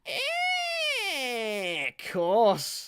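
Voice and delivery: high squeaky shout; screechy football star voice; Steven Gerrard impression. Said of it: "It was more Stevie Gerrard".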